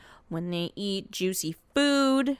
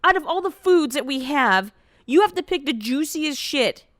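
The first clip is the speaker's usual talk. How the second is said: exasperated; 5 semitones higher than usual. Real, not acted. Disgusted at orange eating.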